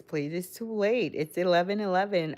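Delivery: ordinary talk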